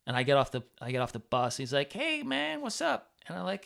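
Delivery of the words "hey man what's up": much higher-pitched hailing voice; imitated friendly greeting. Dance teacher greeting visitor off the bus, high friendly hail. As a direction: imitate someone calling hello, pitched way up.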